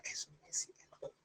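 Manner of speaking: a quiet whispered denial; whispered aside. Real, not acted. Preacher whispering his own objection to the audience. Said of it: "aside under breath".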